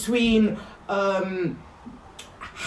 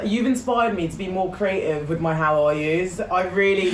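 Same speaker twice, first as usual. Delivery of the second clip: ordinary delivery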